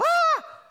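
sudden high, loud startled cry; panicked yelp. Retold or imitated. Pastor acts out Jesus waking in panic with a yelp. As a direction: jolt awake with a shocked shriek. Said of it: "You'd think Jesus would wake up by going".

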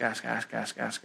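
fading repetition. Retold or imitated. God chides the asker, voice fading to almost nothing. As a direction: let each ask fade away.

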